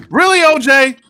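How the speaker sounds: can't believe it, call him out; loud disbelief at the quote; incredulous. Real, not acted. Incredulous reaction.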